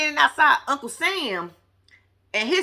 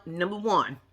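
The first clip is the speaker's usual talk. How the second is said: ordinary delivery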